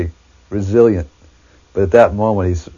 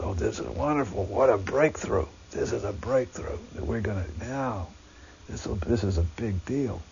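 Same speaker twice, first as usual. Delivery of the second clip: thinking it half to yourself, thrilled; hushed excitement; 2 semitones higher, 13 dB quieter than usual. Retold or imitated. Devotees' hopeful inner thoughts.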